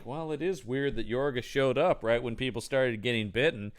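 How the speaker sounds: low, subdued mock line reading; underperformed dialogue. Actors dubbing talk while walking around, low underperformed delivery. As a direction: recite it like a bored dubbing actor. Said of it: "perform it about like this".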